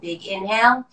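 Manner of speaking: ordinary delivery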